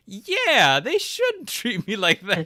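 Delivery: high, loud comic outburst; belated protest, stretched out high and loud; mock indignation. Introvert egged on by girlfriend, mock indignant.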